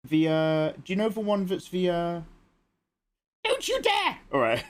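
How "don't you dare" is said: high squeal of don't you dare; playful outcry. Real, not acted. Streamer warning chat off an emote, high playful squeal.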